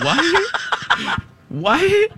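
high, squeaky stunned "what?"; hammed-up shocked question. Replays stunned reaction to woman.

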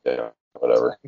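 low, scornful brush-off; mocking sneer; dismissive sneer.

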